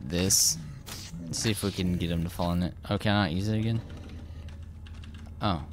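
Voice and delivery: usual speaking voice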